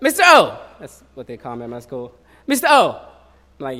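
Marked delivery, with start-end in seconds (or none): 0.0-0.6 loud high call over the speaker. Retold or imitated. School intercom calling the teacher, loud high call. 2.5-3.0 loud high call over the speaker. Retold or imitated. School intercom calling the teacher, loud high call.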